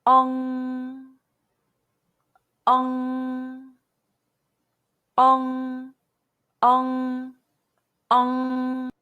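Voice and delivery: each "Ong" held long and level, with pauses; pronunciation drill. Shown as a demonstration. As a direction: model "Ong" for repetition, drawn out into a nasal ng. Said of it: "mimic the mouth shape in the video carefully".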